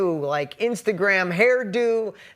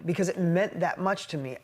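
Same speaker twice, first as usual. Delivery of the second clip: ordinary talk, nothing marked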